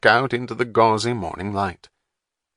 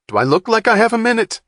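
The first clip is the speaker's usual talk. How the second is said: fast, much higher and louder snap; irritated retort; 13 semitones higher, 7 dB louder, faster than usual. A narrator's reading. Wapner snapping at Saint John, fast irritated retort. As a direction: bite his head off.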